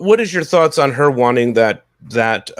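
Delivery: ordinary talk, nothing marked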